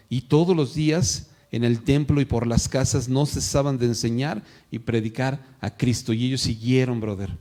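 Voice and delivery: ordinary talk, nothing marked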